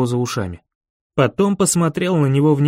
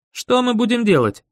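put-on higher-pitched boy's voice; lightly voiced boy, pitched up; boy character; 8 semitones higher than usual. A narrator's reading. Boy asking the narrator what to do, higher boyish voice.

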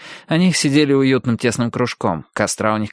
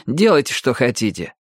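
ordinary delivery, nothing marked

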